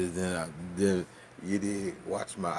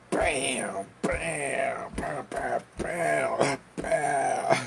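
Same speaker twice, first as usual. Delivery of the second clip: punchy higher bams, one per hit; smacking sounds; 3 semitones higher, much slower than usual. Retold or imitated. Comedian acting out swatting the roach, punchy bams. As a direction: hammer each bam like a swat.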